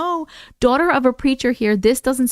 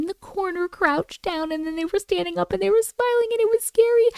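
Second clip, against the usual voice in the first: excited retell; 9 semitones higher than usual. Real, not acted.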